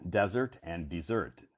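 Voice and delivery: desert and desert, first DEZ-ert then de-ZERT; contrasting pronunciations. Shown as a demonstration. Teacher contrasting desert forms for learners. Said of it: "two pronunciations and two meanings".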